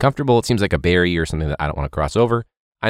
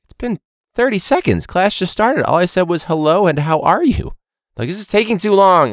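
much higher, louder mock outrage; exasperated teacher; 13 semitones higher, 5 dB louder than usual. Teacher retorting to students who say he talks too much, high and exasperated. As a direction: complain it's only been seconds.

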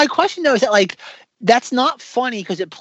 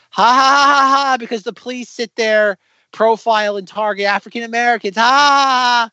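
loud, higher mock laughter spelled out as words; sarcastic fake laugh; 5 semitones higher, 5 dB louder than usual. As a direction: laugh flatly to show it isn't funny. Mocking the predictable sketch joke.

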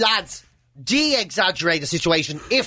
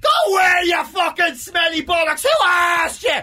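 furious shouting; 7 semitones higher, slower than usual. Acted. Lad roaring at his mate to get out, furious shout. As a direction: scream him out of the room. Retelling the row as it was.

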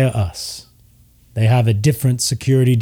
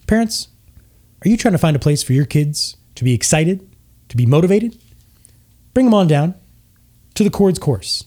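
higher, overexcited commercial pitch; cheesy half-elf ad; 6 semitones higher than usual. Acted. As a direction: sell it like a pushy TV ad host. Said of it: "And he says".

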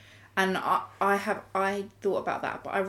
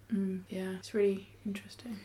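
real whisper, barely voiced; whispered aside; 5 dB quieter than usual. Real, not acted. Musing to the study group in a whisper. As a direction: breathe it as an aside. Quiet aside after discussion.

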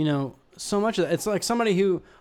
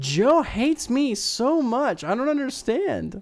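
whiny voice; 7 semitones higher, slower than usual. Pretend. Whiny mock hurt.

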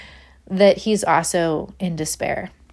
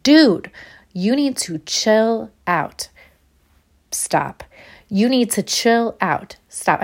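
angry yelling; 3 semitones higher, slower than usual. Retold or imitated. Mom scolding her screaming toddler, angry and slow. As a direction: scold him slowly, fed up. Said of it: "I said it twice in a row, yelling it".

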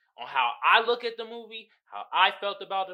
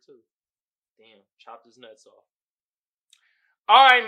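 ordinary delivery

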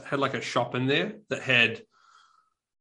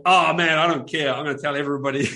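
mock excitement; 3 semitones higher, 7 dB louder than usual. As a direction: play an excited stranger brushing off the facts. Acts out the American guy's excited reply.

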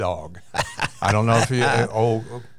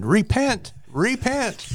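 imitated yelling; 13 semitones higher, slower than usual. Imitating Clyde running after his dog, yelling. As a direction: holler it high like calling a runaway dog.

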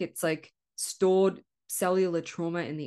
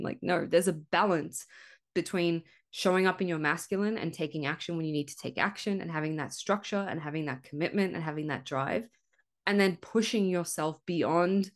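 normal talking voice

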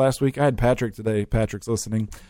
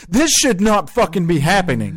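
indignant outburst; 7 semitones higher, 4 dB louder than usual. Retold or imitated. Retelling anger at price haggling.

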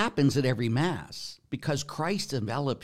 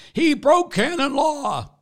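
high, loud, drawn-out wail of protest; hammed-up shrieking complaint; mock outraged cry; 9 dB louder, slower than usual. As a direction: imitate scandalized critics crying out, slowly. Mocking critics' outcry about the Pope.

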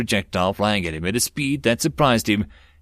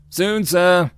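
high, raised-voice soldier's reply; radio man replying; 9 semitones higher, 4 dB louder than usual. A narrator's reading. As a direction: call back briskly to the major. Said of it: "the radio man replied".

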